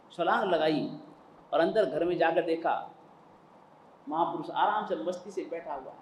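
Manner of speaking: usual speaking voice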